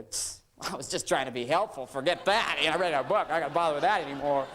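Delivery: mock huffy outburst, building in volume; offended guy. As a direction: grumble defensively like a rebuffed husband. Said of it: "most guys, when you touch, you go".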